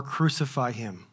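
normal talking voice

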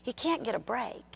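usual speaking voice

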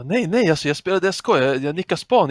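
retold protest. Streamer explaining his nickname to trolls, high imitated protest. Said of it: "Han bara".

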